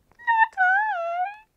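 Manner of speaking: tiny high kitten refusal; high cat voice. Acted. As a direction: squeal it way up high. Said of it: "in her high voice".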